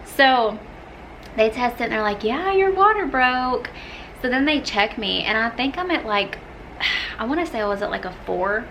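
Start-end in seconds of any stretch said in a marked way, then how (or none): 2.2-3.7 mock nurse. Nurse voiced in retelling.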